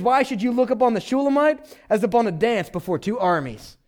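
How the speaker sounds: high-pitched mock-indignant challenge. Solomon shutting out prying eyes, mock indignant.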